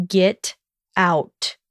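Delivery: curt, cold order; cold demand. Acted. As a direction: order her out flatly. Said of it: "he demanded".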